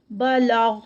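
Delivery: بالغ with light ba and lam, heavy full غ; Quranic recitation. Shown as a demonstration. Teacher modelling recitation of the word. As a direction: recite بالغ, letting the غ sound thick. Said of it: "غین بھی جو ہے وہ heavy letter ہے".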